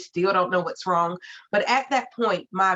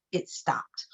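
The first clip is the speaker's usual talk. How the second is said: hushed whisper; 6 semitones lower, 7 dB quieter than usual. Real, not acted. Mother telling parents the apneas suddenly ended, whispering. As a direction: drop to a soft whisper. Climax of the baby's illness.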